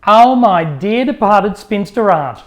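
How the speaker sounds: high, loud exasperated exclamation; exasperated mock oath; mock outrage.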